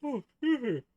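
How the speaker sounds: words stretched by a big yawn. Real, not acted. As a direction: talk right through a yawn. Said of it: "Sorry about the yawn".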